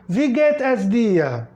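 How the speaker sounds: Wie geht es dir said slowly, each word spaced apart; slow teacher demo. Shown as a demonstration. Teacher modeling the greeting for students, slow and deliberate. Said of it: "o professor diz".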